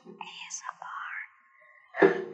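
hushed, whispered letters; ASMR whisper. Shown as a demonstration. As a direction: whisper it softly like an ASMR video. Demonstrating ASMR to cohost.

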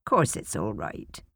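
put-on low, slow, soft old lady voice; elderly neighbour answering gently; kindly old woman. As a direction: reassure her like a kind grandmother.

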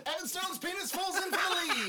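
race caller. Pretend. Mock race call.

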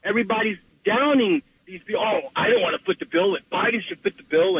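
normal talking voice

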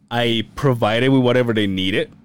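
ordinary delivery

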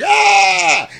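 sudden high yell of burning pain; pained scream. Retold or imitated. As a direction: scream like your hands just caught fire. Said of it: "He's like".

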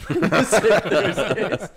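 slow, loud and laughing. Real, not acted. Friend repeats the absurd phrase, laughing. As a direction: repeat it through a fit of laughter.

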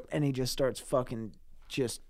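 ordinary talk, nothing marked

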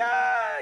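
very high-pitched mock scream. Daughter screams as the kidnappers take her, high imitated scream.

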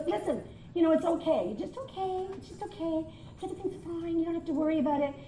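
soft, soothing customer-service voice; calm down an anxious caller, softly; mock-soothing reassurance; gentle reassuring agent.

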